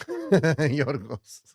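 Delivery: laughing. Real, not acted. Laughs at a viewer's comment.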